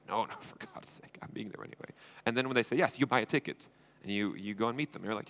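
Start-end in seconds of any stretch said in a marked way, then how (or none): 0.1-1.9 breathy whispered protest through a laugh; whispering, half laughing, to a founder who says don't travel for my sake. Retold or imitated. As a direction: laugh it off under your breath, imitating yourself. Mock reply to a busy founder.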